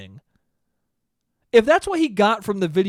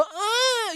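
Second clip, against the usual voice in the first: very high fake moan; mock moan. Shown as a demonstration. Nephew showing what a full mouth couldn't do, mock moan. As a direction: demonstrate a moan, pitched way up. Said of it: "we're not moaning like".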